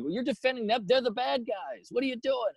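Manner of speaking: high-pitched, exasperated protest; retold indignation, pitched well up; losing it. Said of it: "I lost it on him now 'cause I'm like".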